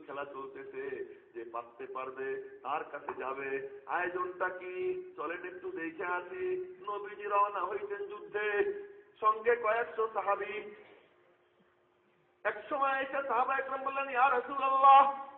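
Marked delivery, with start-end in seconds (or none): 3.9-15.3 loud, high, slowed preacher's cry; preaching at full force. Acted. Preacher calling out to the gathering in a loud, high cry.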